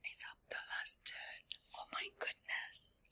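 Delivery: hushed, tense whisper; suspenseful whisper. Real, not acted. Reader whispering to the children as the dive nears.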